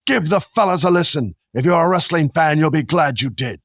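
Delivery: put-on Viking accent, hammed up on the spot. Host reads listener review aloud in an improvised Viking accent. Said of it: "This is my Viking voice".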